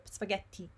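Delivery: spaghetti with a stopped, strong tt; double T demo. Shown as a demonstration. Teacher tips English speakers, strong double T. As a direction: hold before the last syllable of spaghetti. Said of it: "make a D stronger".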